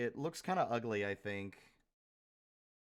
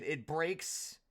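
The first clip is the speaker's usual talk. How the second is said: normal talking voice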